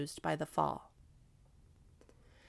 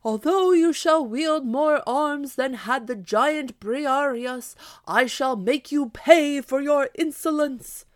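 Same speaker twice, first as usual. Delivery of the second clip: higher, louder, slow defiant knight's shout; shouting again; 6 semitones higher, 8 dB louder, slower than usual. Acted. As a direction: bellow the threat slowly, voice raised high. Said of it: "Don Quixote shouted out again".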